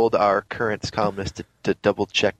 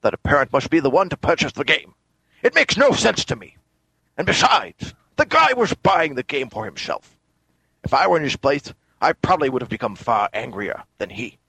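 indignant impression of a Scottish film star; Sean Connery; 2 semitones higher than usual. Co-host disputing a store's refusal, indignant Connery impression. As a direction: argue the point in a hammy Scottish star voice. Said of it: "a passable Sean Connery for you".

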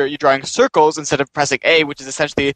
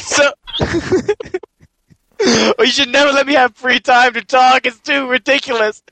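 laughing; 7 semitones higher than usual. Real, not acted. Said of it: "I'm sorry".

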